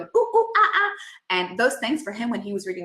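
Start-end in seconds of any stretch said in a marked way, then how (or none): 0.0-0.9 a high, loud ooh ooh ah ah; cheeky monkey chatter; monkey noises. Shown as a demonstration. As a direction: call out like a monkey for a kid. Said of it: "the monkey going".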